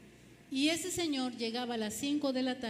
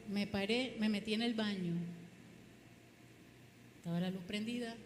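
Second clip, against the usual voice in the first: ordinary talk, nothing marked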